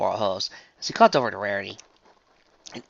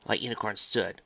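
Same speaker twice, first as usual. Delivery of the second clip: usual speaking voice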